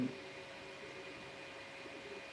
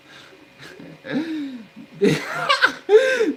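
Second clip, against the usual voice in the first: a high, loud, disbelieving laugh; a real, frustrated burst of laughter; exasperated laugh; 8 dB louder than usual. Real, not acted. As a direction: laugh at how absurd it is.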